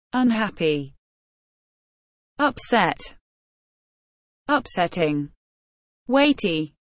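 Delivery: low, heavy reading of each word; mournful vocabulary recital; somber word list. Acted. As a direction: read every word like it weighs on you. Reads list of sad words.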